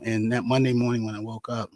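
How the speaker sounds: low, heavy-hearted recollection; subdued grief. Real, not acted. Recalls friend's sudden death.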